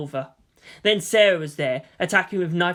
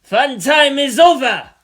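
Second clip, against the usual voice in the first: louder and higher, slow mocking yell; taunting shout; 8 semitones higher, 10 dB louder, slower than usual. A narrator's reading. Martin announcing himself to Nagisa with a slow taunting shout. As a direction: bellow it to break up the party. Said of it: "he shouted hoarsely".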